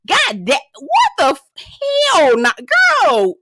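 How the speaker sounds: high, loud, broken-off exclamations; exasperated disbelief. Real, not acted. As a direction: burst out, stretching the last words.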